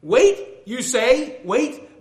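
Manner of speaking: high, loud questioning protest; indignant. Acted. Believer answering God's order to wait, indignant. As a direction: throw it back in indignant disbelief. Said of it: "My indignant reply".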